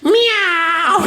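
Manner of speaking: high, loud mewing meow; cat meow. Retold or imitated. Daughter on the bed at night, a sudden high meow. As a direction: yowl it like a cat. Daughter meows then runs.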